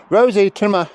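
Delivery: high, loud shout of the dog's name; calling out. Real, not acted. Trainer shouting the dog's name across the field.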